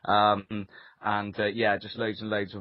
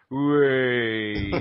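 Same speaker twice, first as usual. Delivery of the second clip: mock crowd cheer; a hammed-up audience whoop; traditional British whee; 6 dB louder than usual. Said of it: "the traditional British".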